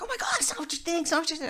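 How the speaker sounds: imitated fast, high-pitched pleading; panicked excuse. Husband to wife after being caught, mock panic. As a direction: gabble it high, mock-frantic.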